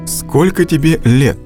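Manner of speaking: usual speaking voice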